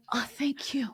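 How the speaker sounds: breathy awed whisper. Retold or imitated. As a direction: whisper it in awe. Quoting her awed reaction.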